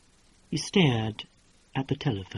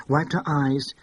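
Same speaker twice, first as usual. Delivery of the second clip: usual speaking voice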